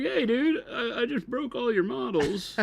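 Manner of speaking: high-pitched comic impression of a politician; Bill Clinton. Co-host playing Chris confessing to breakage, in Bill Clinton voice. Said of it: "gave Chris the Bill Clinton voice".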